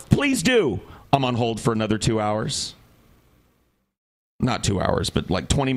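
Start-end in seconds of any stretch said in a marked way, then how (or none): 0.1-0.8 exasperated. Retold or imitated. Man on hold telling ikea agent, exasperated. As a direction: bite it out, fed up.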